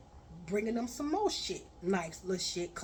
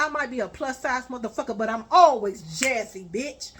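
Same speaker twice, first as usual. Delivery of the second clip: louder and faster, scolding; angry rant; 8 dB louder than usual. Retold or imitated. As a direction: tear into him, raising your voice. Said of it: "I said".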